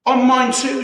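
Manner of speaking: high, loud indignant shout; Mister Twit shouting that he got hit too. A narrator's reading. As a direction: bark it back at her. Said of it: "shouted Mister Twit".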